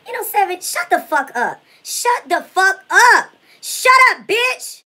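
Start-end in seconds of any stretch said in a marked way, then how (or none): 1.8-3.3 drawn-out louder command; stretched-out angry order; slow, louder shut up. Acted. Telling rival to shut up. 3.6-4.8 high, loud yell; furious shriek; screaming shut up. Real, not acted. Yelling at rival.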